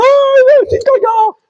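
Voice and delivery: high wailing Ay Diosito; mock whimper. Preacher mocking a weepy youth, high mock whine.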